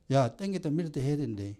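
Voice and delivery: ordinary talk, nothing marked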